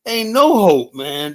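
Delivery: loud high cry that there's no hope; mock despair. Retelling his shock at the checkout, loud high exclamation. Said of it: "I was like".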